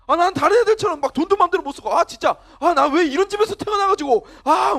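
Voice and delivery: loud, high-pitched hammed-up whining; whiny teen complaint. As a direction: play a teenager griping at parents. Acted teen whining at parents.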